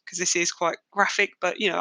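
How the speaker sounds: ordinary delivery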